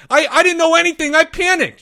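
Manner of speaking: high, loud, fast stammer; panicked protest. Acted. Porge excusing himself to Leo, panicked squeal. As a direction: squeal out a rushed defence.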